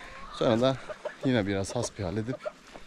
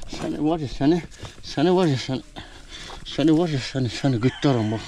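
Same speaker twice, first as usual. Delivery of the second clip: mock threat; 4 semitones higher than usual.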